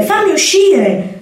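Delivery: trapped kitten wailing, begging to be let out; desperate kitten. Acted. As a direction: stretch the plea out, pleading harder. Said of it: "miagolò disperato".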